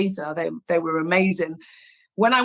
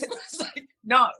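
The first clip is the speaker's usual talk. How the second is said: laughing; 5 semitones higher than usual. Retold or imitated. Body's flat refusal, voiced.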